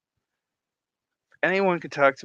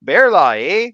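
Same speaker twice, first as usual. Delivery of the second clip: loud mock Canadian accent, lifted on the eh; punchy, exaggerated Canadian twang; 4 semitones higher, 14 dB louder than usual. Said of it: "It'll be Canadian".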